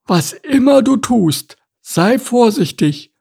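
high, very slow warning; drawn-out, high-pitched admonition; admonishing turtle. A narrator's reading. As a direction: caution slowly and weightily in a high turtle voice. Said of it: "mahnte Larissa".

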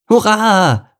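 put-on high, loud kid hooray; high-pitched whoop of joy; jubilant cheer. A narrator's reading. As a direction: shout it out in triumph. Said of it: "jubelte Lukas".

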